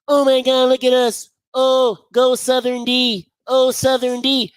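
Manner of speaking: slow, hammed-up fake celebration; mock the rival fans' gloating. Fan mocking rivals who would cheer a safety, slow mock gloat. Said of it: "let Chris Saunders get a safety".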